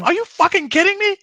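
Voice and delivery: very high, loud cry of disbelief; incredulous outrage. Real, not acted. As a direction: shout it high, genuinely outraged. Disbelief at the trade.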